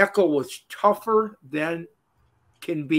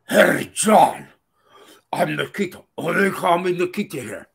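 mock Russian. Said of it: "only Russian, okay?".

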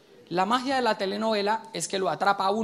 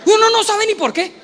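much higher, louder squeal of disbelief; exasperated squeal; 13 semitones higher, 9 dB louder than usual. Acted. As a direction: squeak it out, baffled. Exasperación cómica del hombre. (chillido exasperado, mucho más agudo y fuerte)

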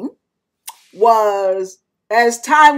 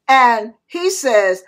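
ordinary talk, nothing marked

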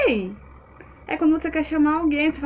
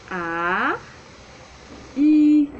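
slow, separated vowels; 2 semitones higher, 4 dB louder than usual. Shown as a demonstration. Teacher joining two vowels for the class, slowly and separately. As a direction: stretch A and I as two separate sounds. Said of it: "Agora vamos falar um pouquinho mais rápido".

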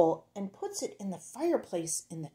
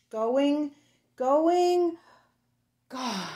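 building then fading; 8 semitones higher, 6 dB louder, much slower than usual. Acted. Train fading away in story.

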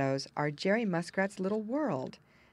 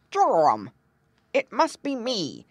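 put-on alarmed old frog; 3 semitones higher, 8 dB louder than usual. Grandfather Frog exclaims alarmed.

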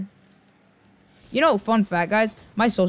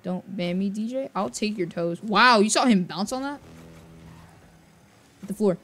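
ordinary talk

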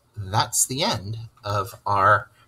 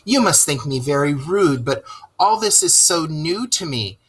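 high, eager little mole voice; wide-eyed newcomer, slowing on the last question; shy Mole; 8 semitones higher, 4 dB louder than usual. Acted. Mole apologises to Rat, high-voiced and awed.

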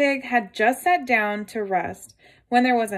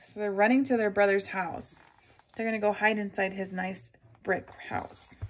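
plain reading voice

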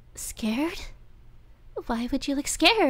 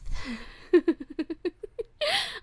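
giddy laugh; 6 semitones higher than usual. Acted. Laughter at gagged captive.

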